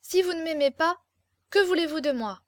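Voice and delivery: firm, insistent challenge; demanding straight answer. A narrator's reading. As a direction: corner them and allow no evasion. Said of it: "d'un ton qui n'admettait plus de faux fuyants".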